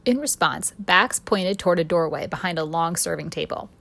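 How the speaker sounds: plain reading voice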